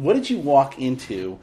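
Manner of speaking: usual speaking voice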